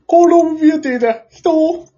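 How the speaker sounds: high, cute voice announcing a game; chirpy mascot hosting a stream; mascot streamer voice. Pretend. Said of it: "って。".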